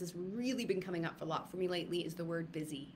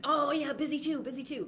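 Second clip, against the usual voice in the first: high-pitched, chirpy stock answer; put-on busy-person chatter; mock small-talk reply; 8 semitones higher, 5 dB louder than usual. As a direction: imitate an over-eager acquaintance, pitched up. Acts out small talk about being busy.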